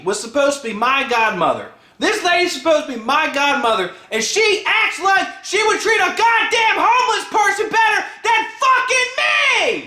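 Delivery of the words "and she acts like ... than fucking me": angry cry of being wronged; furious shout. Real, not acted. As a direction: spit it out in real outrage. Ranting about godmother.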